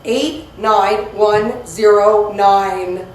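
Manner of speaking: slow, digit by digit; dictating. Real, not acted. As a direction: read each digit slowly. Repeating zip code slowly.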